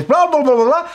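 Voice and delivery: high, dismissive babble; blah-blah squawked in mockery; mocking blah-blah. Mocks the critics' endless noise.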